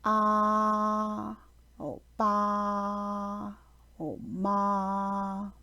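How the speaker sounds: Mandarin first tone on ah, ba, ma, flat and unchanging. Shown as a demonstration. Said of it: "examples of the first tone would be".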